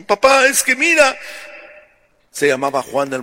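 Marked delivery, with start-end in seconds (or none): 0.1-1.2 whiny daughter. Acted. Bride complains to father.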